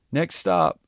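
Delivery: projected firm announcement; loud announcement. A narrator's reading. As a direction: repeat it loud for everyone. Repeats for all present.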